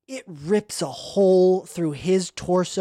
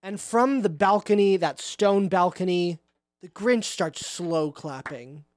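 ordinary delivery

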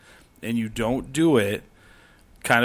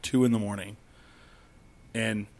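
ordinary delivery

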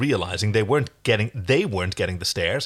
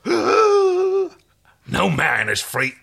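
high, very slow scandalised line; shocked; 10 semitones higher, 4 dB louder, much slower than usual. Acted. Stallkeeper answers John's claim of freedom, shocked. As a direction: draw it out in theatrical shock. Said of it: "The stallkeeper gasped in shock".

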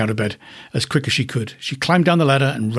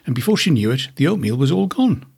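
plain reading voice

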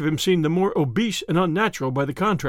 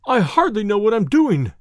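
higher, mildly flustered exclamation; distressed cry; 4 semitones higher, 4 dB louder than usual. A narrator's reading. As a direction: cry it out, somewhat overwhelmed. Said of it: "he cried".

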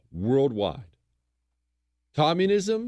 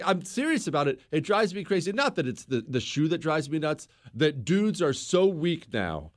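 normal talking voice

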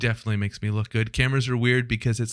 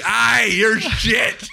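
grumpy woken man; 9 semitones higher, 4 dB louder, much slower than usual. Retold or imitated. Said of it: "He wakes up, he's like".

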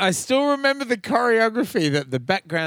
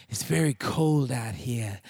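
sultry; 5 semitones lower, 5 dB quieter than usual. Retold or imitated. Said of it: "complained about the air conditioning in the most, uh, sultry way".

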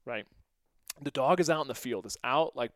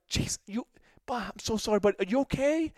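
worried apology; 9 semitones higher, 3 dB louder than usual. Retold or imitated. Apologizing after hitting teammate.

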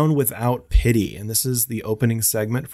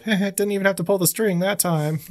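doll quote; 9 semitones higher than usual. Retold or imitated. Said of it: "he also follows up".

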